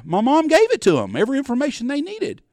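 loud, high-pitched exclamation; exasperated. Real, not acted. Son telling how mom gave away everything, loudly exasperated. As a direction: voice leaps up in real exasperation.

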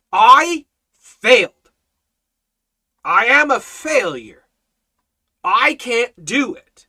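loud, high, impassioned admission; emphatic confession. Real, not acted. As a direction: punch each short sentence, pausing between. Preacher emphatically confesses his own inadequacy.

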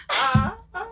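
high, strained little cry-gasps, half laughing; silent crying gasps. Retold or imitated. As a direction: imitate stifled sobs after a spanking. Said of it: "After, just like".

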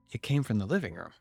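ordinary delivery

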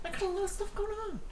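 high, fast squeaky mock voice; mock busy voice. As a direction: play it silly and rushed. Mocking a busy person.

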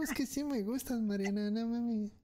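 high, earnest pleading, voice pushed way up; pleading guy. Acted. A guy telling Mariana he really likes her, pleading high. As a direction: beg her to believe you mean it.

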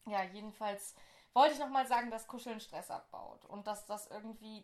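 normal talking voice

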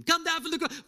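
high, loud taunt; mocking jeer. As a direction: jeer again. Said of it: "They were mocking him".

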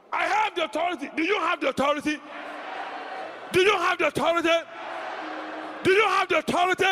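preacher shouting the question at the congregation; preaching at full force. Real, not acted. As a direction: drive the question home to the crowd.

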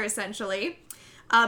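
ordinary talk

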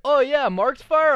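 loud high surprise; surprised realization. Retold or imitated. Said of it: "He's like".

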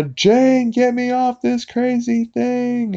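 very high, drawn-out panicked cartoon holler; imitated cartoon yell. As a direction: cry for help like a cartoon dad, slow and high. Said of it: "you know, he yells".

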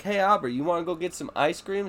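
high, bright offer; sweet bait. Retold or imitated. Dad luring daughter with ice cream, bright and high. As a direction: dangle the treat cheerily. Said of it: "If I'm like".

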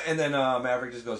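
ordinary delivery

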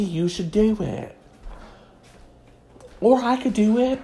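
ordinary talk, nothing marked

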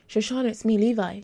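calling out loud through the door; shouting. Acted. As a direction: call his name through the closed door. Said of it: "Detective Levi shouted".

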